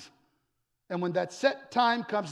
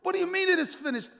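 high, rushed disbelieving question; incredulous disciples; 12 semitones higher, much faster than usual. Acted. Disciples questioning Jesus' last words, incredulous. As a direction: fire it back in disbelief. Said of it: "the disciples are like".

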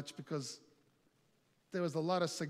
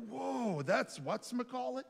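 higher, wide-eyed mock amazement; impressed whoa; 7 semitones higher than usual. Guys on the porch reading the wine label, amazed. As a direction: gasp over the famous label. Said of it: "they were like".

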